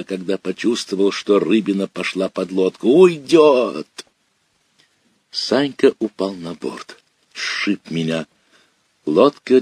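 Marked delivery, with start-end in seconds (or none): 2.9-3.9 higher, louder panicked yell. Acted. Boy feels the fish going under the boat, panicked yell. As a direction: wail that the fish is escaping. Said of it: "завопил я".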